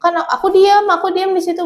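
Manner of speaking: the hurt spilling into the voice; upset, voice rising. Real, not acted. Recounting being hit, rising distress.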